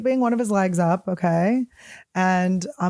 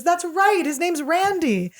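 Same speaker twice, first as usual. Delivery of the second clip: put-on British accent; 8 semitones higher, slower than usual. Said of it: "This is my British accent".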